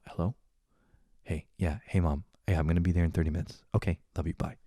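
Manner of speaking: hushed, low, discreet phone call; library-quiet phone voice. Shown as a demonstration. Taking mom's call on a quiet train, hushed. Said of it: "like I'm in a fucking library".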